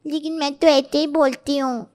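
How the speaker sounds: put-on lisping young wife voice; lisping bride. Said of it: "ये कैसे बोल रही हो तुम निमिता".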